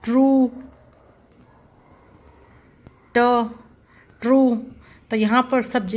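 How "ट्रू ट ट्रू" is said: ट्रू said a little higher with a crisp retroflex ट; teacher demo of ट्रू with a crisp ट; hard t in true. Shown as a demonstration. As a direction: snap the ट in ट्रू clearly. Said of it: "ट की sound आती है".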